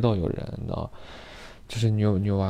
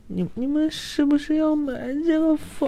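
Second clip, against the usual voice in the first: very high, sugary falsetto; high coy falsetto question; coy girl voice. Retold or imitated. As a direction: ask sweetly, like a pampered girl. Mimicking the coy tenant.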